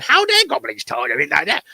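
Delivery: high, indignant puppet goblin; Labyrinth goblin. Retold or imitated. Imitating a Labyrinth goblin taking offence, pitched high. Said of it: "took the idea of the Labyrinth".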